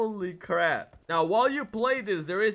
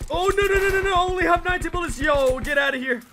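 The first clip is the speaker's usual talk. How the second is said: frantic shouting; 5 semitones higher than usual. Real, not acted. Frantic fight, low ammo.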